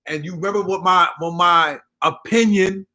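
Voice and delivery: usual speaking voice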